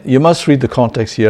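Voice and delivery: ordinary talk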